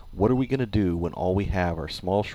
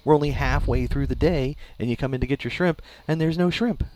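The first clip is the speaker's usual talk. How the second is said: mock angry customer; 9 semitones higher than usual. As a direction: play the fed-up customer scolding the shop. Mock-angry customer complaint to manager.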